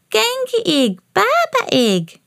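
put-on very high toddler voice, drawn out; toddler George shouting. A narrator's reading. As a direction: babyish high call, consonants missing. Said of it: "shouted George".

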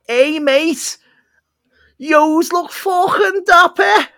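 put-on high, loud, slow Liverpool accent shout; Scouse impression. Liverpool youth calling to men in suits, loud Scouse accent. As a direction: bellow it like a Scouse lad across the street. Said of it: "Paul O'Grady to make an appearance".

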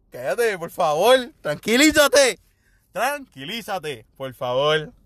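agudo y lento, pidiéndole calma; súplica exasperada, estirando las palabras; calmando a mami. Retold or imitated. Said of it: "Y yo like". (le pide a su mamá asustada que se calme, agudo y lento)

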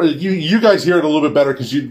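normal talking voice

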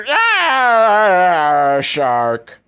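high, loud startled yelp then flat shark; mock frightened shriek. Mother seeing a man exposed, shrieking in mock terror. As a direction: shriek it like she's seen a shark. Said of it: "she'd see the cock and be like".

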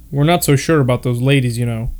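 ordinary delivery, nothing marked

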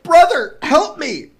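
high, loud desperate plea; cry for help. A narrator's reading. Soldier to Saint Peter in rising water, crying out. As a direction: cry out loudly for rescue. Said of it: "and then he cried".

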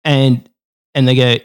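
ordinary talk, nothing marked

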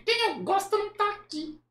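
very high puppet voice; squeaky dummy confiding; Kleber. Acted. Puppet hinting at his idol, high puppet voice. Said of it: "acha uma voz que pareça com o boneco".

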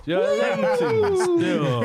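high, slow hyped-up praise; excited compliment. Pretend. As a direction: stretch it out, thrilled. Acting out hyping up partner.

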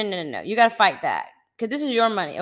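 ordinary talk, nothing marked

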